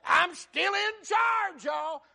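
high, drawn-out preacher's shout; emphatic declaration. Acted. God reassures the people He rules, emphatic shout. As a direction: shout it out slowly as God asserting command.

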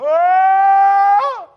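loud high wailing "Oh!"; a long mourning wail; wailing cry. Retold or imitated. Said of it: "She began to go".

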